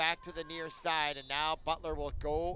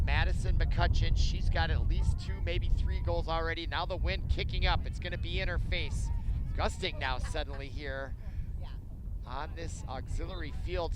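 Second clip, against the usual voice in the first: excited play-by-play; 6 dB louder than usual. Acted. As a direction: lean in loud on the big chance.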